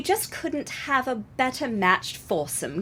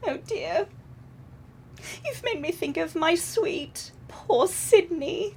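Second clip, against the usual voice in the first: tearful; 6 semitones higher than usual. Acted. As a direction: let the tears rise in the voice. Sister tearing up over husband.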